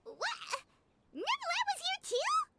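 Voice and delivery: high-pitched startled questions; acted astonishment. Guide learns Neuvillette visited too, acted astonishment. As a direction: burst out in disbelief.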